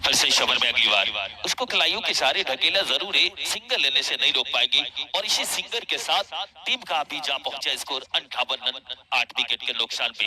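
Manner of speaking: loud live play-by-play; excited cricket commentary. Real, not acted. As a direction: call the action with punch.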